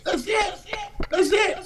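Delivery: high, laughing shout of relief; excited shout. Real, not acted. Celebrating the new coach's arrival, shouting happily. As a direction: burst out in triumphant joy.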